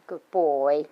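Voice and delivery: low, loud praise for the dog; praising dog. Shown as a demonstration. Trainer praising the puppy, deep and loud. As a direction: reward him with a deep good boy. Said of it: "and the praising".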